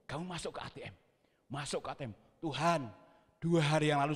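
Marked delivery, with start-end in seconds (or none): 0.1-2.1 quiet, lowered divine prompting; voice of God. Retold or imitated. God directing the preacher to the cash machine, hushed voice. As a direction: instruct softly, like an inner voice. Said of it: "ada suara begini".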